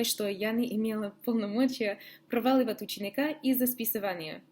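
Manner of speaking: normal talking voice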